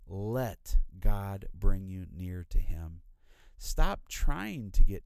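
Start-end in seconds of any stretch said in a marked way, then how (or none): 0.1-3.0 "let" drawn out and emphasized, slow phrase; stress on LET. Shown as a demonstration. Preacher urging listeners to yield to God, stress on "let". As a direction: lean hard on "let", then take the rest slowly. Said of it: "emphasis on the first word here".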